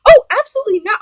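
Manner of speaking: high, loud mock outrage; indignant refusal. Woman rejecting date's request, loud indignant refusal. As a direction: burst out with an appalled no.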